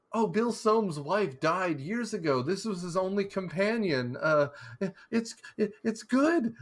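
a terrified father pretending all is fine; strained, rising mock fear; scared dad. Frightened dad placating son.

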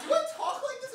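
very high, loud mimicry; Jenna. Friend mimicking Jenna questioning her voice, high impression. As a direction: push it into a squeaky caricature. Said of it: "Jenna voice is so".

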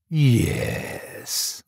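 drawn-out, whispered yes with a grin; grinning. A narrator's reading. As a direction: breathe it out with knowing relish.

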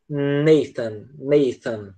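"Nathan" com a língua entre os dentes, lento. Shown as a demonstration. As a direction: sopre o TH de "Nathan" devagar. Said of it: "com o T H a gente tem um som do teta". ("Nathan" com o som do teta, devagar)

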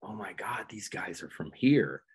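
hushed amazement; awed whisper. Retold or imitated. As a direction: whisper it like a secret discovery. Awed realization about the band.

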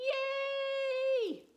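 high happy yay; cheering yay. Acted. Reader celebrating sister's success, cheering.

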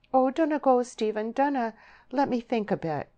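high, urgent plea; pleading. A narrator's reading. Deborah stopping Stephen as he turns away, pleading. Woman begs lover to stay.

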